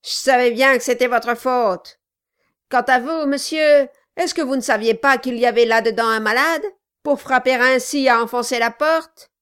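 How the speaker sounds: loud, angry scolding; angry dwarf. A narrator's reading. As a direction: snap at him in anger. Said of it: "un regard de colère".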